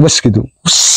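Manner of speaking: one "wes", then a long hissed whoosh; small single whoosh. Shown as a demonstration. Telling which paired bird wins, single imitated whoosh. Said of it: "yang suaranya kecil ya".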